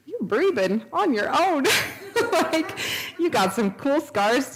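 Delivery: slow, lifted voice of wonder; slow, amazed, delighted; awed delight. Retold or imitated. Amazed at former patient alive.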